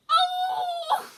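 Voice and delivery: very high, loud delighted squeal. Retold or imitated. Fan recalling her squeal from the concert video, high shriek. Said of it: "hear me in my video going".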